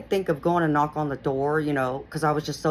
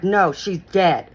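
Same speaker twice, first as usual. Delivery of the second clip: harsh retort; 3 semitones higher, 7 dB louder, slower than usual. Retold or imitated. Harsh man slamming the door.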